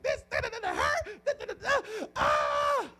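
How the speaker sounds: very high, censored cursing fit; mock pained tirade; imitated cursing. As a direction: rant in nonsense syllables, ending in a yell. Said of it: "she was cursing up a storm".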